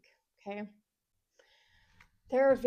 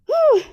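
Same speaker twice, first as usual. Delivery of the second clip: effortful whew; 15 dB louder than usual. Real, not acted. Exhale during hard plank hold.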